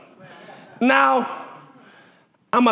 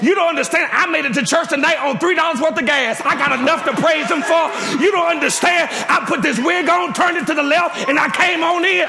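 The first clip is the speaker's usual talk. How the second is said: a touch of raised preaching, higher and pushed; testifying preacher; 4 semitones higher than usual. Acted. Preacher telling the church her story, lightly raised preaching. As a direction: testify to the pews with rising energy.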